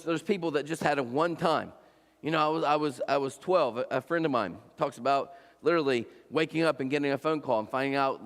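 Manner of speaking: normal talking voice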